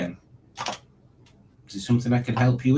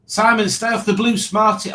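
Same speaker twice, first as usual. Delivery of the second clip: excited; 10 semitones higher, 4 dB louder than usual. Real, not acted. Reading a chat message aloud.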